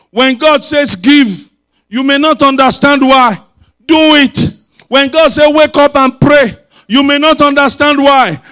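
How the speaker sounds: loud, high emphatic exhortation; forceful preaching. Real, not acted. Preacher urging the congregation to obey God, forceful preaching. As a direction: drive each command home loudly.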